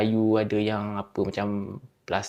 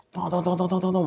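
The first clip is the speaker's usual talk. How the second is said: higher, rhythmic mock knocking; knocking sound effect; 6 semitones higher than usual. Shown as a demonstration. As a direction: imitate banging on a bathroom door. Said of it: "Aku tak pandai sangat buat sound effect". (tiruan bunyi ketukan)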